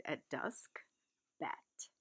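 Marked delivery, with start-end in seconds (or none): 1.4-1.9 whisper. Acted. Child echoes the strange word.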